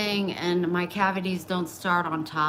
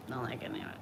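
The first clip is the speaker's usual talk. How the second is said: drops lower and quieter; quiet, lower echo of the line; softer repeat; 4 semitones lower, 8 dB quieter, much faster than usual. Real, not acted. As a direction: let the repeat sink down and fade. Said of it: "repeated, softer".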